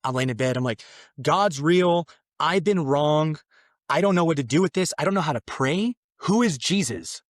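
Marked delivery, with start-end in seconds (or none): 1.2-7.2 loud, high and urgent; blurting it out in a rush; breathless news he can't hold in; excited outburst. Retold or imitated. Frantic text to Andrea, retold.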